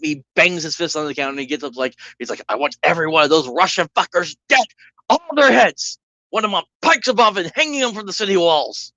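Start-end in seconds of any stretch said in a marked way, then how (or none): 2.5-8.9 furious mob boss. Acted. Mafia father to his sons about the Russians, furious shout. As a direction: bellow it like a boss demanding revenge.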